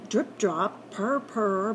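ordinary delivery, nothing marked

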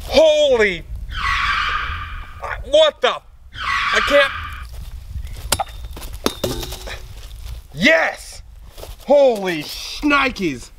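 high, stunned shouts of disbelief; wild excitement. Acted. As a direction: lose it over the find. Reenacted big find.

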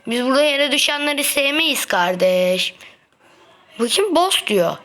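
ordinary talk, nothing marked

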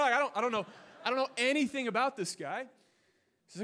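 ordinary delivery

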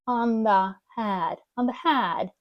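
"On the head" run together as "onthehead"; slow first, then glue "On the head" into one word; linked words. Shown as a demonstration. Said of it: "склеїти ці всі слова разом".

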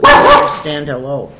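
loud, stressed "You"; emphatic. Real, not acted. Teacher insisting to the class, loud "You understand". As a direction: drive the word "You" home, loud, then fall.

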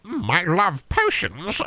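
drawn-out wizardly purr. Acted. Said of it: "Please read this in a wizard voice".

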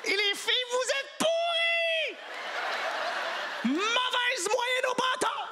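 shrill high taunting yell, slowed; high mocking shout. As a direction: jeer at the girls in a high voice.

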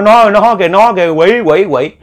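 high, loud terrified yell; screaming alarm. Retold or imitated. Hồng crying out to the villagers at the demon's face, terrified yell. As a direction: scream it out as you spot the demon. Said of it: "Cô mới la làng".

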